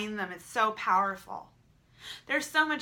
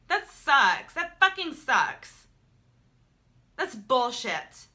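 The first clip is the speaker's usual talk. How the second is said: louder, slowed bitter swearing; frustrated venting; 4 semitones higher, 6 dB louder, much slower than usual. Real, not acted. Vlogger cursing the takedown, bitter and loud. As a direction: spit out the frustration slowly. Venting about videos being deleted.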